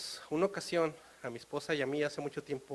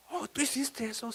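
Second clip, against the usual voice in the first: mother's shocked question; 8 semitones higher, 3 dB quieter than usual. Retold or imitated. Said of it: "mother surprised".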